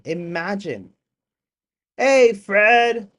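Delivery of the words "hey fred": high, loud cheerful hey; excited greeting. Real, not acted. Streamer greeting a viewer in the chat, loud and excited. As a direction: call out to a friend.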